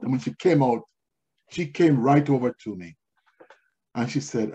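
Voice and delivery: ordinary talk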